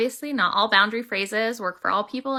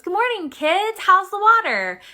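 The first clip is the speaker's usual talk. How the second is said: put-on pitched way up, cheery greeting to the kids; a chirpy, much higher old fish saying hello; older fish voice; 11 semitones higher, 5 dB louder than usual. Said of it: "And the older fish goes".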